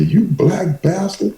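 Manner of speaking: high, slow, mock-furious mother; imitating an angry Southern mother; mother cursing him. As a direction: spit it out like an outraged mama. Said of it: "she said".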